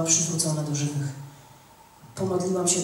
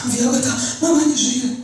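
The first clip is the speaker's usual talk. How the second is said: tym głosem; 4 semitones higher than usual. Retold or imitated. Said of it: "Takim głosem".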